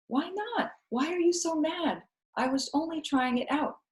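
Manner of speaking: put-on high, puzzled little girl; child voice. A narrator's reading. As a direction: ask innocently, not understanding. Child questioning Viola.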